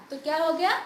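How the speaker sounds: ordinary delivery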